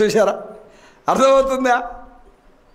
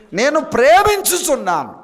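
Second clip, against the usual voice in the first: proclaimed line; 9 semitones higher, 4 dB louder than usual. Acted. Proclaiming verse.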